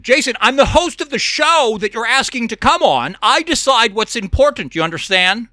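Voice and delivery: usual speaking voice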